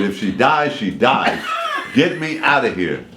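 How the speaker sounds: laughing while half-shouting. Retold or imitated. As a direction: laugh through the lines. Laughing through the quote.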